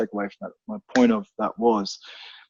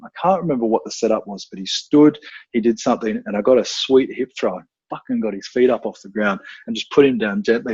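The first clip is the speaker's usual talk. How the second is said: ordinary delivery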